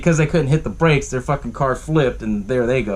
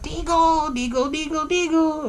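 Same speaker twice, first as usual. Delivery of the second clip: high, soft squeaky chanting; imitated puppet gremlin; 14 semitones higher, 3 dB quieter, slower than usual. Retelling a gremlin working a puppet, high puppet voice. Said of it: "the puppeteering and shit".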